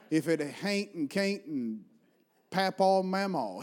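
drawn-out Appalachian country words; country accent. Shown as a demonstration. Preacher shows congregation homely dialect, slow country accent. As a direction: stretch the Appalachian vowels, slowly. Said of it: "use you and your accent".

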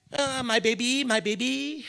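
high, cooing owner's voice; doting dog owner. Retold or imitated. Crazy dog owner fussing over the dog, doting coo.

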